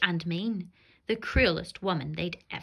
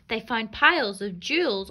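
plain reading voice

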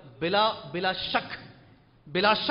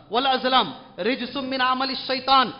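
Quran recitation; 4 semitones higher, 3 dB louder, slower than usual. Acted. Quranic verse recited in sermon.

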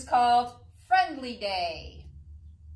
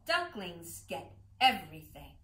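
usual speaking voice

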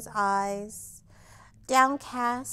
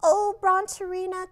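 higher, louder lament; cried; 7 semitones higher, 7 dB louder than usual. A narrator's reading. Teacher lamenting to Brontorina, dismayed cry. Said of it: "cried Madame Lucille".

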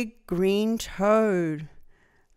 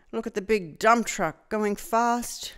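plain reading voice

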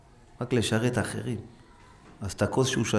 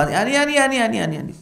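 high, loud me-me-me; eager chorus; 11 semitones higher, 7 dB louder than usual. Acted. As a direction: clamour to be the one. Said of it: "אחד שאל".

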